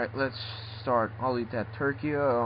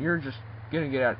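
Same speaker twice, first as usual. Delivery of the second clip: ordinary talk, nothing marked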